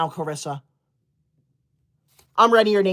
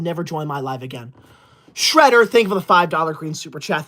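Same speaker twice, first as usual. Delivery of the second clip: ordinary talk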